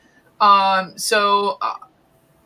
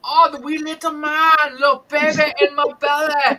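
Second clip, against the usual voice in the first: Fat Bastard impression; 6 semitones higher than usual. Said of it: "Is that fat bastard?".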